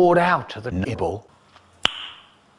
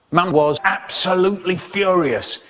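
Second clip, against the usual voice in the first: usual speaking voice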